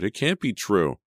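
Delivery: distressed cry. A narrator's reading. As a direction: protest in dismay, voice lifting. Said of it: "she cried".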